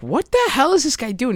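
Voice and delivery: high-pitched mock bewilderment; baffled onlooker. Onlookers watching the boomerang inventor, baffled.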